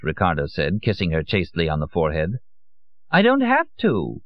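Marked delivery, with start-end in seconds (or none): 3.1-4.2 high and slow, a touch defiant; lightly voiced Lorenza. A narrator's reading. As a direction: brush off the advice airily. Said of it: "she replied, to Belbo".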